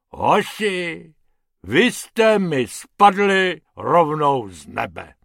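lightly voiced hippo, loud and pompous; a big animal introducing himself grandly.

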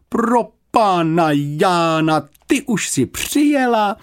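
high, loud blurt of dismay; dismayed older woman's outburst; horrified exclamation. A narrator's reading. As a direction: cry out aghast, stretching the first words. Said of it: "vyhrkla zděšeně".

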